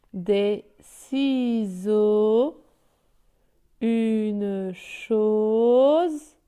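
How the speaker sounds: very slow, long held vowels and sustained Z; slow model reading. Shown as a demonstration. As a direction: model each word for beginners, stretching it right out. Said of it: "Je lis la lettre, les mots". (lecture modèle très lente, voyelles longuement tenues)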